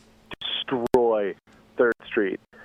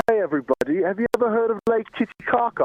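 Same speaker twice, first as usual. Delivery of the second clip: much higher put-on British accent; mock British comic; 12 semitones higher than usual. The fake British comic opens his set in a much higher mock British accent. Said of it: "he'd get up there and be like".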